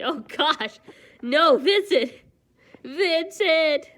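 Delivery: loud horrified protest; outraged. Real, not acted. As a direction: yell at him in disbelief. Outraged reaction to character.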